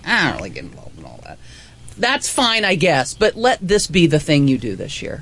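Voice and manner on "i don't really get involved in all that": uninterested person. Retold or imitated. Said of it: "maybe like".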